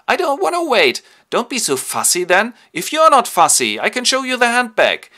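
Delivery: high, loud whining; hammed-up high petulant whine; mock whiny young lovers. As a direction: squeal it like impatient teenagers.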